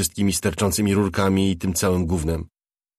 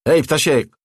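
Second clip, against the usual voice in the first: loud, higher call across the yard; 7 semitones higher, 7 dB louder than usual. Acted. Slick calls after staggering Ptasiek, loud call. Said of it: "zawołał ślizg".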